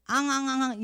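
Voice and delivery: loud repeated mock wails; imitated crying. Teacher asks if Junha has such crying inside, repeated mock wails. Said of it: "준하는 그냥 아파서 우는 거지".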